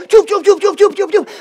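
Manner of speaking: high, punchy "chup, chup" stroke sounds; imitated fast strokes. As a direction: beat out quick strokes with the mouth. Swimmers racing off again.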